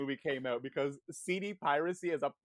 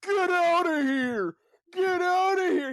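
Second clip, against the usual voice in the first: high, drawn-out full-volume yell; yelling dad; 8 dB louder, slower than usual. Retold or imitated. Imitating the movie dad yelling at his son, loud shout. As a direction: holler it loud, stretching the words. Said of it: "yells at his son".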